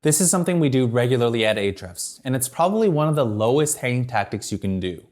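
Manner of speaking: usual speaking voice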